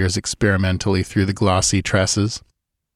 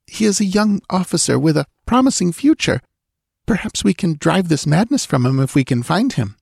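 put-on higher voice, anxious young woman pleading; frightened young woman; 10 semitones higher than usual. A narrator's reading. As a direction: voice the scared dancing girl, pitched up. Girl voiced in story.

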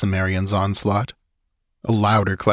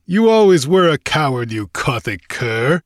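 louder, contemptuous sneer; scornful taunt; 5 semitones higher, 3 dB louder than usual. A narrator's reading. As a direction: taunt him as a coward. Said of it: "sneered Conan with bitter scorn".

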